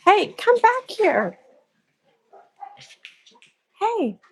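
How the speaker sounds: high-pitched call to a straying dog; real high, scolding call; calling a dog back. Real, not acted. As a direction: pitch it well up to catch the dog's attention.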